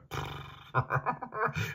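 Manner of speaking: hohes, gehauchtes Pferdekichern; Kichern. Acted. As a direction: kichere wie ein gutmütiges Pferd. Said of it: "Das Pferd kicherte". (Pferd kichert über Shasta, gehaucht und hoch)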